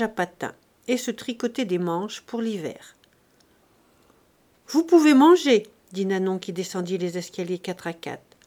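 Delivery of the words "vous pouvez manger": loud, high servant's call. Acted. Servant coming downstairs to announce breakfast, calling out. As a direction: call it out brightly across the house.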